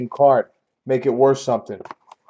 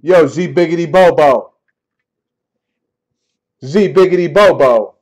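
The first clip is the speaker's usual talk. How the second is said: raised, punchy call-out; shouting; 2 semitones higher, 4 dB louder than usual. Real, not acted. Streamer hailing a viewer by nickname, half-shouted. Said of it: "You're shouting again".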